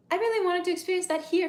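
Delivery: high, loud yearning; eager longing. Real, not acted. Streamer remembering wanting New Year's party abroad, eager. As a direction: lean into how much you wanted it.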